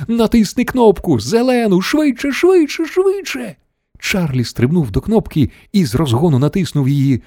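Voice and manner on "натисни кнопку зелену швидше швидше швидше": very high, climbing frantic cries; urgent shouting. A narrator's reading. Wonka urging Charlie to press the button, high frantic cries. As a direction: urge frantically, pitch climbing with each repeat. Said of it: "крикнув містер Вонка".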